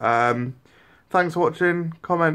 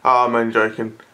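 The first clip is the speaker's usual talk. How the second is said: ordinary talk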